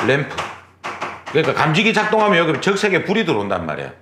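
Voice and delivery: ordinary talk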